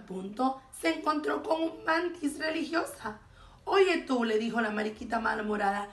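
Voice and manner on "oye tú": high, loud challenge; brash call. A narrator's reading. Grumpy ladybug hailing a praying mantis, loud and brash. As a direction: hail them pushily.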